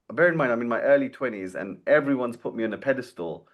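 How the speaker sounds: ordinary talk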